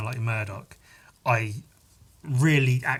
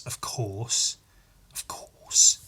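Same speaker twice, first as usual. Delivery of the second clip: tailing into whisper; 2 semitones lower, much slower than usual. Pretend. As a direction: repeat fading down to a whisper. Drops to whispered repeats for comic effect.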